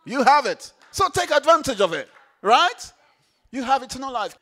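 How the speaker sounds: loud, rousing sermon build; preaching at full force. Acted. Preacher rousing the congregation, loud. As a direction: preach it out big to the room.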